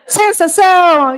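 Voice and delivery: high, loud triumphant announcement; excited reveal. Real, not acted. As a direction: proclaim the answer with delight. Revealing the right answer.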